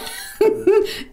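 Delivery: laugh. Real, not acted. Laughing at own story.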